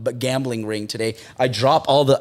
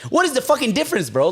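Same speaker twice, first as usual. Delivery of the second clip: much higher, exasperated protest; outraged question; 10 semitones higher than usual. Retold or imitated. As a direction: demand an answer from the guard, baffled. Arguing with guard about pants.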